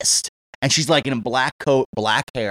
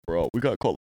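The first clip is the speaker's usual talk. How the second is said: low, much softer conspiratorial line; hushed; 8 semitones lower, 7 dB quieter than usual. Retold or imitated. Super to the speaker in the laundry room, hushed. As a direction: keep it down between the two of them.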